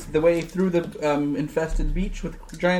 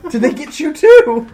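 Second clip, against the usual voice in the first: panicked shout; 10 semitones higher, 12 dB louder than usual. Acted. Panicked question to a friend.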